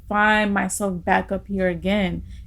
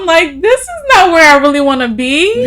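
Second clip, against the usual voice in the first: teary laughter, much higher and louder; laughing through tears; 9 semitones higher, 10 dB louder than usual. Real, not acted. Recalling the mentor's call to her co-host, laughing, voice breaking high. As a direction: laugh while fighting back tears. Recounting crying after the call.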